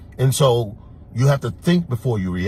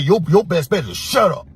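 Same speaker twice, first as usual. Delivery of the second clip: higher, louder hard warning; louder stern shut-up order; retold stern warning; 6 semitones higher, 4 dB louder than usual. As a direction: cut him off, firm and threatening. Telling a barber off.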